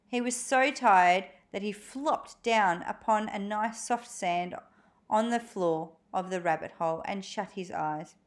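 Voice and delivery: ordinary delivery, nothing marked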